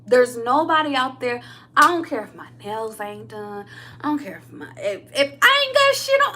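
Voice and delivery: normal talking voice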